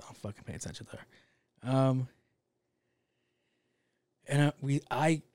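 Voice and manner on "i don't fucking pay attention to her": whispered aside. Real, not acted. Dismissive aside about his sister.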